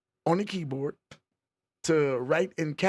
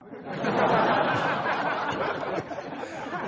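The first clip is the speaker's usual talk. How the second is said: high laugh; 10 semitones higher than usual. Real, not acted. Laughs at Kelly's comment.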